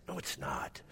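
small, soft, mock-tearful protest; retold weeping. Weeping dad answers comforting daughter, soft mock-tearful voice. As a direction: protest quietly as if crying. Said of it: "I just began to weep".

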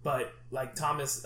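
ordinary talk, nothing marked

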